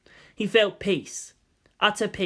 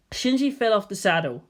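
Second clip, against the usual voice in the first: ordinary delivery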